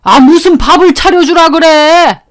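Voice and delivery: put-on irritated old man barking about lunch; annoyed shout. Father-in-law waves off his wife, irritated shout. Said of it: "아버님이 그러시더라고요".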